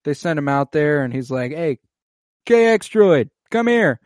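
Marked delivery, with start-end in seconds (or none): 1.5-4.0 high, loud mock summons; calling out. Guard sends him out to work and hails a droid, calling out. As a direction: call a droid over, pitched well up.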